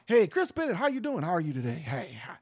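mock smooth host, sinking low and soft; buttery radio voice. Shown as a demonstration. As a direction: slide down into a buttery, hushed greeting. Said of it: "put that buttery voice on".

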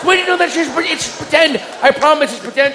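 high mock-shouted reassurance; calm her down in a high panic; high pleading dad voice; frantic reassuring dad.

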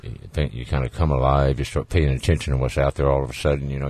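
normal talking voice

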